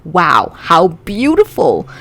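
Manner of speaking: loud, slow, swooping fake awe; mocking admiration. Brother teasing his sister about her song, mocking admiration. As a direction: gush it in drawn-out mockery. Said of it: "He's wiping away fake tears".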